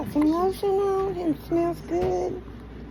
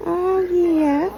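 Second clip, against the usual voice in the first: ordinary talk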